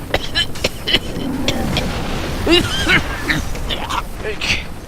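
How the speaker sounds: hammed-up poisoned coughing fit; mock coughing. Player fakes a coughing fit after being poisoned. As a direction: cough like you've been poisoned. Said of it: "I'm poisoned".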